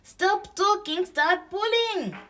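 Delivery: very high, loud bird cry; shrieked order from a little bird; urgent calling. A narrator's reading. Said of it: "called Tick Bird".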